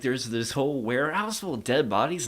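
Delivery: usual speaking voice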